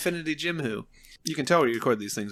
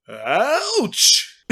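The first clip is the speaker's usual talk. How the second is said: high, loud "ouch" of pain; hammed-up cry, pitched way up; mock pained yelp; 10 semitones higher, 7 dB louder than usual. Thanos as Ant-Man grows inside him, comic pained yelp. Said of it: "and Thanos goes".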